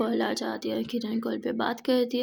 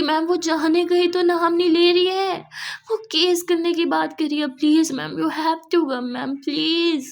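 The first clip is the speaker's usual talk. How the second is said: high, loud desperate plea; urgent begging; 4 semitones higher, 5 dB louder, slower than usual. Acted. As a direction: drag out the please, begging hard. Employee begging boss urgently.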